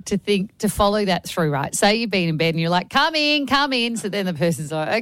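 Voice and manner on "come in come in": higher-pitched mock call to a visitor. Host imagines caller summoning a visitor from bed, mock calling out. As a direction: lightly call out as if from upstairs.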